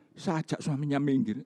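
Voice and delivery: ordinary delivery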